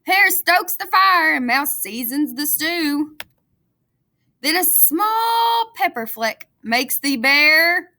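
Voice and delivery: put-on lively read-aloud for young children; storybook reading. A narrator's reading. Teacher reading a picture book to her class, lively storytelling.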